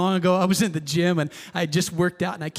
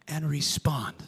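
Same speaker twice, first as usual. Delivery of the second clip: whisper; 5 semitones lower, 7 dB quieter than usual. Real, not acted. End of a hushed quote.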